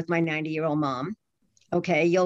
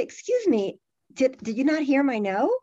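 indignant high retort; 6 semitones higher than usual. Pretend. Confronting a younger boundary breaker.

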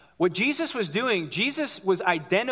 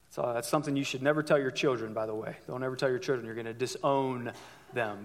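soft, much lower throwaway joke; quiet aside; 9 semitones lower, 7 dB quieter than usual. Real, not acted. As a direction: drop the voice for a wry aside. Wry aside to the congregation.

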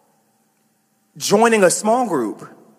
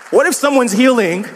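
usual speaking voice

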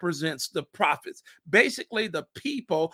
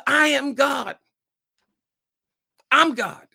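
declaring shout; 5 semitones higher, 5 dB louder than usual. Acted. Jesus declares, acted shout.

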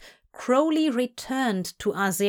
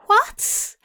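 choked, much higher squeak; 4 dB louder than usual. Acted. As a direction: choke out the word in shock. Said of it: "Aziraphale choked out".

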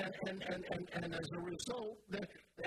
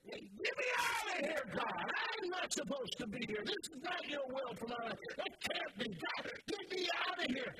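high, loud shouting complaint; mock scream at God; 8 semitones higher, 3 dB louder than usual. Preacher mocking believers who yell at God, shouting high. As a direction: yell it at heaven like a trapped teen. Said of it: "scream out at God".

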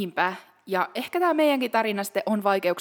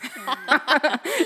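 high bubbling laugh; delighted giggling laugh; burst of laughter; 5 semitones higher than usual. Real, not acted. Laughs at sister's answer.

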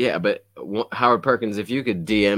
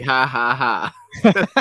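sarcastic laugh; 5 semitones higher, 7 dB louder, much slower than usual. Retold or imitated. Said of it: "He's like".

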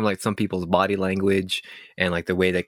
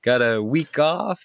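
louder host intro, stretched episode number; show intro; 7 semitones higher, 3 dB louder than usual. Acted. Host opening the podcast episode, loud announcer style.

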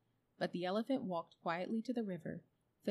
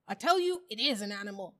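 insistent bulldog; 2 semitones higher, 8 dB louder than usual. A narrator's reading. Bulldog argues back at the animals, insistent. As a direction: insist forcefully against the doubters.

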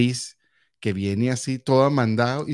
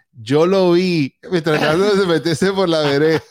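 tattletale; 6 semitones higher, 6 dB louder, slower than usual. Retold or imitated. Tattling on brother to mom.

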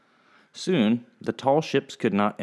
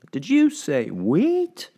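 high, very slow, cold question; lady horrified at the gift; disbelief; 10 semitones higher, 4 dB louder, much slower than usual. Acted. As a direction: make him repeat it.

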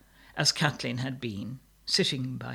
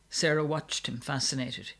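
ordinary delivery, nothing marked

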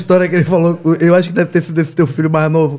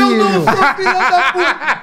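slow, higher, put-on child voice; mock hurt kid; 5 semitones higher, slower than usual. Friend repeating the son's hurt protest. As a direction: mimic the offended little boy. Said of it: "repeats kid's line mockingly".

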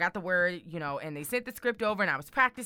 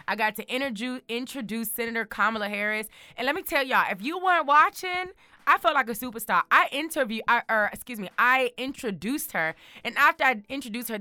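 ordinary talk

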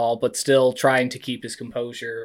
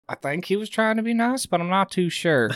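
timid voice; 7 semitones higher than usual. Acted. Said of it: "is just like".

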